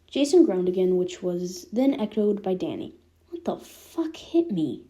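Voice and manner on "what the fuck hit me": slow, rasping groggy words; rasped out. A narrator's reading. Danny waking in the med bay, slow and rasping. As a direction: rasp it out like just waking from a knockout. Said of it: "Danny rasped out".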